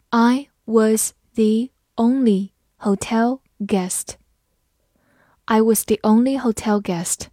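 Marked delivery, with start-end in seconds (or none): 0.1-4.2 slow, word by word; slow reading. Shown as a demonstration. Teacher reading slowly for students to follow. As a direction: read it slowly for learners. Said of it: "好，慢慢来读".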